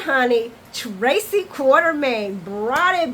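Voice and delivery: slow, drawn-out, punched praise; emphatic gushing. Real, not acted. Host gushing over two soap actors, emphatic and drawn out. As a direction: hammer each name with rising relish.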